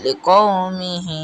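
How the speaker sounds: slow "লিকৌমিহি" with a long-held "হি"; recited "লিকৌমিহি", stretched final syllable; elongated ending. Shown as a demonstration. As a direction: draw out the last vowel of "লিকৌমিহি". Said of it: "টান দিতে হবে".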